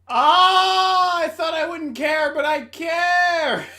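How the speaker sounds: high, loud laughing wail; laughing outcry. Real, not acted. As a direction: cry out in shocked amusement. Reacting to gruesome story scene.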